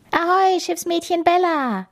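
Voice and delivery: high-pitched pirate captain's hail; calling out. A narrator's reading. Pirate captain hailing Bella to play, calling out. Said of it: "rief Kapitän Kugelbauch".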